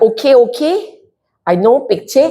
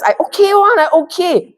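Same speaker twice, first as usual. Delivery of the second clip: high, insistent "I okay"; mock insistence; 11 semitones higher than usual. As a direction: protest too much, pitched up.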